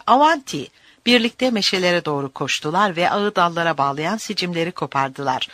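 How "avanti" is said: high-pitched rallying cry; shouted call. A narrator's reading. Luigi urges them on toward the oaks, calling out. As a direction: call it out, a touch less forceful shout. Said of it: "diye bağırdı Luigi".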